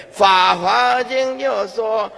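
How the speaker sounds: loud, high scripture declamation; mock preaching. Lay Buddhist starting another sutra at deathbed, mock declaiming. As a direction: launch grandly into yet another sutra.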